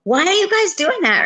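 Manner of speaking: high, indignant mom protest; mock scolding. Mom scolding kids over grandbabies, indignant.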